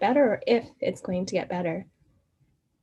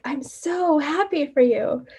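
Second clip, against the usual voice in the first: high, slow gush of delight; gushing joy; 6 semitones higher, slower than usual. Retold or imitated. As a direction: pour out delight at the good news. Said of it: "And she's like".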